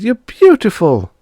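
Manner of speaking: very high, loud delighted cry; a little girl awestruck; amazed girl. Acted. Bonnie seeing Bob transformed, very high delighted voice.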